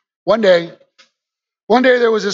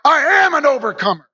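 shouted declaration; 7 semitones higher than usual. Pretend. Believer declaring faith over fear, shouting slowly. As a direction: declare victory with full force. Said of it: "you gotta go".

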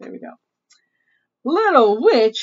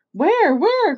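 high, raised cry of where; puzzled little outcry, pitched up; crying out a question; 8 semitones higher, 4 dB louder than usual. Acted. Cousin Dippy to Little Witch about a runny nose, crying out. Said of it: "cried Cousin Dippy".

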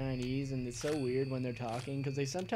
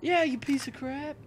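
mock taunt; 12 semitones higher, 3 dB louder than usual. Taunting enemy voice.

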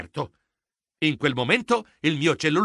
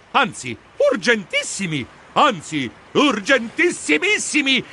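URGENTISSIMI then URGENTISSIMISSIMI, each more emphatic; escalating emphasis; 6 semitones higher than usual. A narrator's reading. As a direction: build up to a higher-pitched "urgentissimissimi". Narrator escalates the superlatives.

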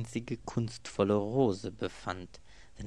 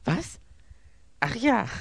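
hoch und laut aufgeschreckt; plötzlich begreifen; überrascht; 9 dB louder than usual. A narrator's reading. (erschrocken hochfahrend)

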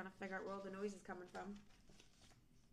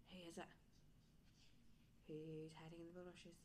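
softer, lower question; hushed; 4 semitones lower, 12 dB quieter than usual. Acted. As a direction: ask softly, peering around.

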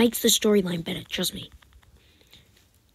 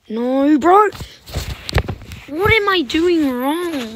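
high, loud, exasperated; frustrated yell; 8 semitones higher, 10 dB louder, slower than usual. Real, not acted. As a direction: wail at the screen.

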